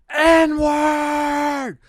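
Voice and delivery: high, loud mock yell of a stranger in the street; imitated screaming. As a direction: belt it out like a man yelling at night. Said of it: "screaming the N-word on the top of his lungs".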